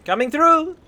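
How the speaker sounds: high, loud echo of a game unit; mimicked game voice line. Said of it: "I quote the in-game voice lines".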